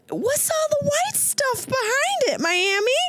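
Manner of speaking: high squeaky accusing questions; mock interrogation. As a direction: grill Miami in a shrill mock outrage.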